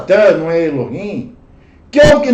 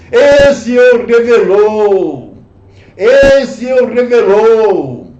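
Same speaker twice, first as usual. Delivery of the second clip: louder, slow, weighty repetition; slow loud emphasis; 2 semitones higher, 5 dB louder, much slower than usual. Acted. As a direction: hammer the phrase home slowly. Preacher repeats phrase louder, slower. (repetição lenta e mais forte)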